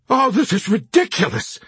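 shout, patience gone. Acted. Chaplain losing patience with the officers, shouting. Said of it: "the chaplain shouted".

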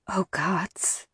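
soft stricken whisper. A narrator's reading. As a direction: breathe it out in shock. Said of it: "I whispered".

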